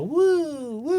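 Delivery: high mock siren woo; a recruit imitating a bubblegum siren; siren noise. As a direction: wail it like a toy siren. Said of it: "bubblegum siren".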